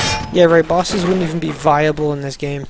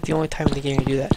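ordinary talk

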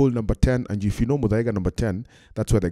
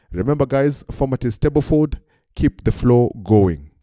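louder, carrying mock official voice; starter instructing caddies; 2 semitones higher, 5 dB louder than usual. Starter reminds the caddies of the format, calling out. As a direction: imitate a starter sending players along, slowing at the end. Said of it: "starter telling the caddies".

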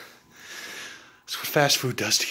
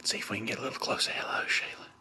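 whispering; 2 semitones lower, 11 dB quieter, much faster than usual. Pretend. Nature show creeping closer.

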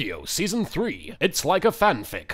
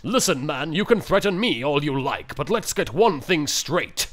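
normal talking voice

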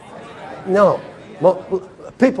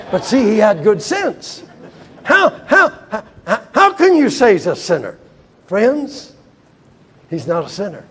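normal talking voice

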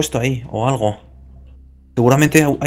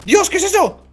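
high panicked cries; panicked shout; 12 semitones higher than usual. Real, not acted. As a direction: yell in sudden fright. Animatronics appear, panic.

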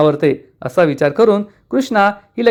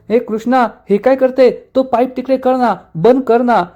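raised-pitch, urgent-sounding protest; mild protest; 6 semitones higher than usual. A narrator's reading. Drenched Dushyant telling Krishna to move the pipe, mild protest. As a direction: protest in a higher narrated voice.

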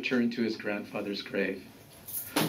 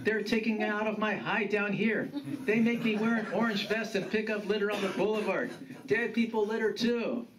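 grumbling grandfather, rising in pitch as he rants; aggrieved grandfather; 7 semitones higher than usual. Acted. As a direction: build from admission to higher-pitched complaint.

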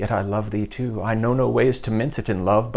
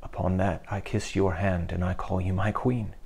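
tender murmur; 4 semitones lower, 7 dB quieter than usual. Acted. Henry tenderly kisses her hand.

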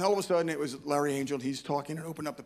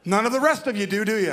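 louder, higher playful jab at the crowd; teasing; 4 semitones higher, 7 dB louder than usual. Pretend. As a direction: poke at the audience. Teasing the audience.

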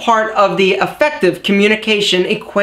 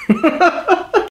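real laugh; 6 semitones higher than usual. Real, not acted. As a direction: burst of laughter. Laughing at his tongue twister.

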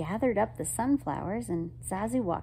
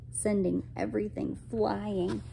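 plain reading voice

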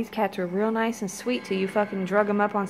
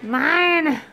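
louder, higher greedy cat voice, building; a bossy cat grabbing its catnip; cat voice yelling at her brother; voicing the cat; 5 semitones higher, 5 dB louder than usual. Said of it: "She's like".

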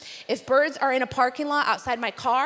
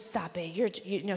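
embarrassed hush; 7 semitones lower, 9 dB quieter than usual. Retold or imitated. Embarrassed friend hushing her.

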